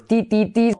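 "ti, ti, ti" with a long high ee; "ti" drill. Shown as a demonstration. Teacher showing how teacher starts: ti, ti, ti. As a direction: stress the I sound in "ti". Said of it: "se pronuncia como I".

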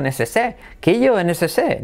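necesé in a southern Spanish accent, the final sound dropped. Shown as a demonstration. Teacher jokes with a southern Spanish accent to teach necessary. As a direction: clip it Andalusian style, necesé. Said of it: "dicho al estilo más sureño".

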